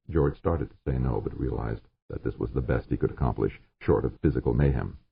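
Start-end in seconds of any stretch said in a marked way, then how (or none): none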